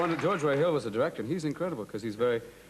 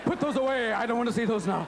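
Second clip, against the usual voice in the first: high, lightly voiced lady, a bit louder; lady's voice; 7 semitones higher, 3 dB louder than usual. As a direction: brush them off like a fussy lady. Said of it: "This lady going".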